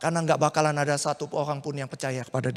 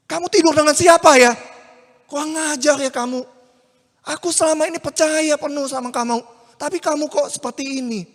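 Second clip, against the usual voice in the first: much higher, shouted betrayed rage; furious accusation; 8 semitones higher, 7 dB louder than usual. Acted. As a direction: lash out at her. Yusuf angrily accuses Maria. (Yusuf marah menuduh Maria, berteriak)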